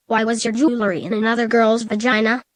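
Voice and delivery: usual speaking voice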